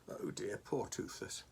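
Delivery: hushed, pitying aside; soft sympathy. Real, not acted. Reader pities Toothless for Oscar's sake, soft sympathy. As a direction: murmur it gently, feeling sorry.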